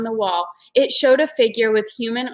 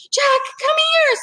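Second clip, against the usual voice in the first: put-on very high girl calling out; 4 dB louder than usual. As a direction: Annie calls Jack over. Annie calls her brother.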